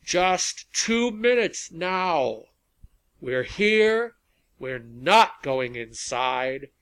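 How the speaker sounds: high, loud, measured oratory; rally speech. A narrator's reading. Rally leader two minutes before sunset, orating to the crowd. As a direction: ring out each phrase to stir a crowd.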